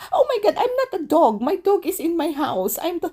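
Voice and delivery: very high, loud and fast, laughing mock friend; Laura's outburst. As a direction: squeal it like the indignant Italian friend. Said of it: "she said".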